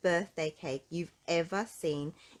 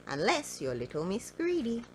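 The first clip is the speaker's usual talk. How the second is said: ordinary delivery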